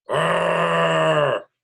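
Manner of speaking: a loud, high bear growl; loud cartoon bear growl. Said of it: "growled Old Bear".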